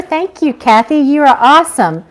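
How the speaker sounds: normal talking voice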